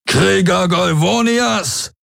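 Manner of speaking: high, drawn-out rallying cry; battle call. A narrator's reading. Warlord Daraios summons his warriors to storm the breach, calling out. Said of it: "rief Daraios".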